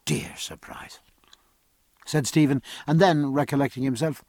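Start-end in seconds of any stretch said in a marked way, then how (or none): 0.1-1.0 whisper. Acted. Fond aside about the ship.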